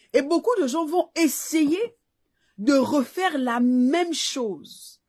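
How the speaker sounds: rising pulpit exhortation to the faithful; fervent preaching. Acted. Preacher urging women listeners about success, fervent preaching.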